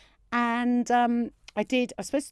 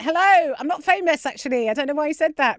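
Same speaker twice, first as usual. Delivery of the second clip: nervous voice pitched much higher; higher and louder with stage nerves; 8 semitones higher, 8 dB louder than usual. Retold or imitated. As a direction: squeak it out, rattled. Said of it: "my voice starts to get high-pitched".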